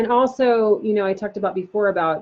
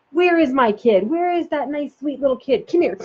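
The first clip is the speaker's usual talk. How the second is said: put-on higher, sing-song searching for the child; playful parent seeking; 6 semitones higher than usual. Shown as a demonstration. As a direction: pretend you can't find the sweet kid. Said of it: "just getting in there".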